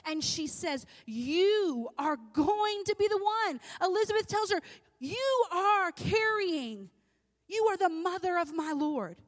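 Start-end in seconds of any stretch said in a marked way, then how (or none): 1.0-3.6 slow, high, loud declaration; emphatic proclamation. Acted. Preacher voices the news given to Mary, slow and emphatic. 5.0-9.2 high, loud, drawn-out declaration; emphatic proclamation. Acted. Elizabeth tells Mary whom she carries, slow and emphatic.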